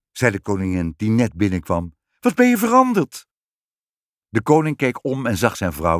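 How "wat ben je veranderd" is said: very high, astonished exclamation; amazed. A narrator's reading. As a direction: marvel at the change, pitched way up. Queen amazed at changed husband.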